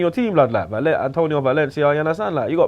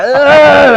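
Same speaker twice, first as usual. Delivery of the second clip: loud, high scornful grunt; mock grunt at fans; 11 semitones higher, 10 dB louder than usual. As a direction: let out a taunting ugh. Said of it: "he's like".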